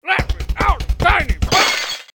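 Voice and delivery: high, loud panicked yell. Acted. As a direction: shout to get out of the box. Trapped, yelling to be released.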